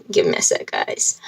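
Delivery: soft unvoiced aside to viewers; quick hushed apology to viewers; whispered aside. Real, not acted. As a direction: whisper it while stepping away. Aside pausing the video.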